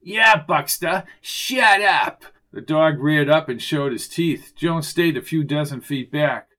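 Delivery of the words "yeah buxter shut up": slow, high, loud taunt; higher, louder mocking put-down; jeering echo. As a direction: pile on with a drawn-out jeer. Arnie jeers at the officer.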